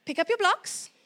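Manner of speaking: mean every word; assertive command. Shown as a demonstration. Mom showing the assertive style, firm steady command. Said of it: "Then you get assertive".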